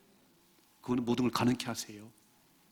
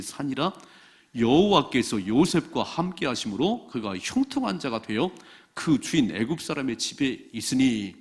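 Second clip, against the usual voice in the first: slow, loud congregational Bible reading; scripture reading; 2 semitones lower, 5 dB louder than usual. Real, not acted. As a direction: read scripture out loudly in measured phrases.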